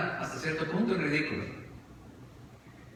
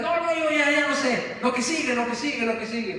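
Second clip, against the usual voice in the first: higher, fading insistence; impatient boy; 6 semitones higher than usual. Acted. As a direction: keep pushing then trail off. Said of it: "Y el muchacho desesperado".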